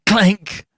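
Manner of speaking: high, loud clanging noise; imitated clank. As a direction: ring out like a falling metal basin. Basin clattering, imitated.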